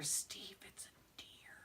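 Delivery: barely audible whisper. Retold or imitated. Wife telling her husband about the deer, whispering. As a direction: whisper it so the deer won't hear. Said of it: "I whisper".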